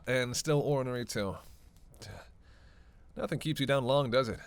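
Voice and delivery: ordinary talk, nothing marked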